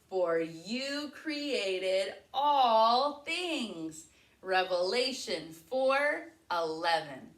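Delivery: slow, loud Bible verse recitation with hand motions; recited verse. Shown as a demonstration. Teacher leading the class verse, slow and loud. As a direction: chant it out slowly for the kids to follow. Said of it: "I want to hear you say it nice and loud".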